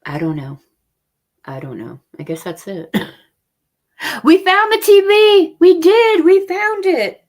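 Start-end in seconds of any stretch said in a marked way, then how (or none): 4.2-7.2 high, loud, drawn-out cheer; elated, loud and high-pitched announcement; excited shout. Real, not acted. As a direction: shout the triumphant news. Announces the lost TV is found.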